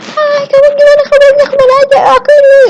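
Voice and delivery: shrill exaggerated sweetness, way too much; over-the-top greeting. Shown as a demonstration. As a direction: overdo the hello like a gushing fan. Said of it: "nggak asik juga orang lihatnya".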